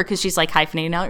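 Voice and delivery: ordinary delivery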